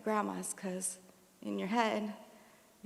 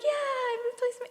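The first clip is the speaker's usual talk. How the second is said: proud dad; 14 semitones higher, 4 dB louder than usual. Retold or imitated.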